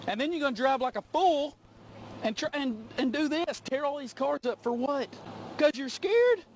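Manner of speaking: rising high angry rebuke; angry scolding. Real, not acted. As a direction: tear into the driver. Trooper scolding caught driver.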